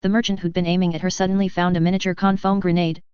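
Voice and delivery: usual speaking voice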